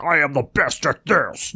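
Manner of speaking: hammed-up braggart, voice lifted; mock boasting. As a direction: play a show-off, slowly and softly. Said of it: "not a guy who sits there and swings my cot around".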